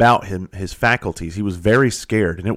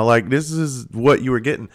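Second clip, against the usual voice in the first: usual speaking voice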